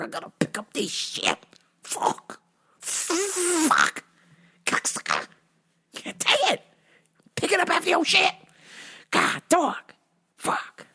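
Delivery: mock cursing in whispered, squeaky bursts; strained grumbling. Owner picking up after dog, strained cursing. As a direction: strain out curses under your breath.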